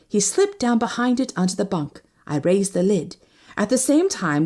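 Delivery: ordinary delivery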